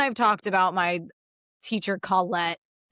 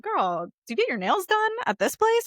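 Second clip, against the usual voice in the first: higher, chatty mom asking about nails; sassy mom; 12 semitones higher than usual. Retold or imitated. School moms asking the psychologist about nails, higher chatty mom. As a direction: gush like a mom eyeing nails. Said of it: "they'd be like".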